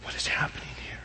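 hushed, breathless whisper; awed whisper. Acted. The crowd whispers to itself in awe. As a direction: whisper it like you can't believe it. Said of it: "crowd whispering in awe".

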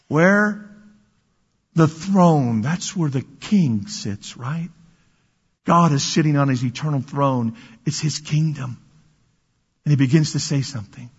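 usual speaking voice

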